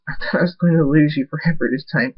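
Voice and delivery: acted tearful confession to a loved one; crying. A narrator's reading. Abel cries into patient's chest, tearful. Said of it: "crying. He says".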